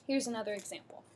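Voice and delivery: normal talking voice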